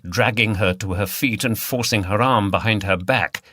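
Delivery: ordinary delivery, nothing marked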